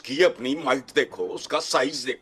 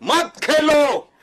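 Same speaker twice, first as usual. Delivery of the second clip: loud, high, drawn-out outburst; angry shouting; 10 semitones higher, 5 dB louder, slower than usual. Real, not acted. Guest cutting off the host's objection about playing cricket, angry shout. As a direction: shout it down, stretching the words.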